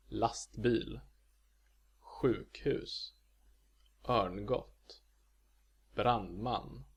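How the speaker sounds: lastbil, sjukhus, örngott, brandman, each double-peaked; Swedish accent two. Shown as a demonstration. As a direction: give both halves of lastbil, sjukhus, örngott, brandman a tonal peak. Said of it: "Also in compound words".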